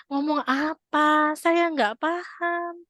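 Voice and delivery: slow, drawn-out bewildered reply; confused husband. Retold or imitated. Husband lost in wife's rant, slow and puzzled. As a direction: spell out that you can't follow.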